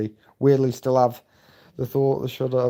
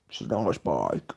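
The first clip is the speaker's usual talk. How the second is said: low mock voice; 10 semitones lower, 7 dB quieter than usual. Reading a viewer's chat message.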